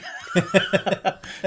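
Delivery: loud high cackle. Retold or imitated. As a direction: cackle like a mad villain. Cackle after the Missy quote.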